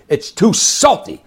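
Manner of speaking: high, loud, slow mock yell; yelling at the chef. Restaurant owner yelling at his chef over salty fish, mock yell. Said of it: "he's yelling at the chef".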